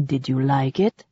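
low, soft playful male character voice. A narrator's reading. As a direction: tease gently, low and soft. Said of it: "he asked playfully".

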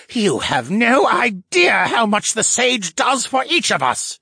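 high, slow, impassioned retort. A narrator's reading. Captive merman defends his leader, retorting. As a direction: insist hotly, stretching the words high. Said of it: "he retorted".

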